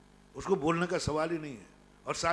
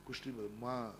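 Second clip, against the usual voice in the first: usual speaking voice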